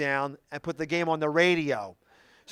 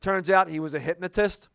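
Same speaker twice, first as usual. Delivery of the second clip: normal talking voice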